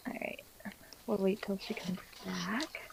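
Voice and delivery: soft, slow whisper. Real, not acted. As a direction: whisper it to the group. Waiting for a player to reconnect.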